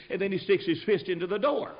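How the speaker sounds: ordinary delivery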